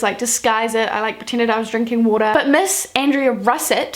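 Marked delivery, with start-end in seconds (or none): none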